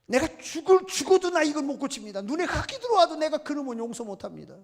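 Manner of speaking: hammed-up obstinate outburst; stubborn defiance. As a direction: dig in heels, vowing never to budge. Mimicking a stubborn unforgiving person.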